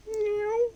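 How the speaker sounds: very high squeaky meow; high cat meow. Pretend. Streamer doing a second, squeakier cat noise for chat. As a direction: squeak out a tiny kitten meow. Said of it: "can't not do cat noises".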